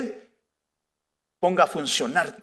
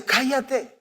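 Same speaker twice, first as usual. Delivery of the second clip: silencing; 5 semitones higher, 4 dB quieter than usual. Retold or imitated. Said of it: "ellos le decían".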